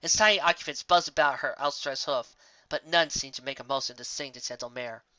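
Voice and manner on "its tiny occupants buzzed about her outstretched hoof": usual speaking voice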